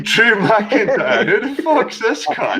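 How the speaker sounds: high, slow mocking disbelief; mock incredulous. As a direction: stretch the name out in scorn. Mocking a wrestler.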